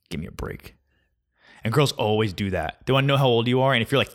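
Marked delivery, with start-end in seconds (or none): 0.1-0.8 muttered aside. Real, not acted. Exasperated aside.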